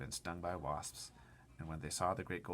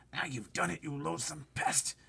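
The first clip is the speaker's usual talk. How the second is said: angry whisper; 8 semitones higher, 4 dB louder than usual. Acted. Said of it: "whispered the earthworm".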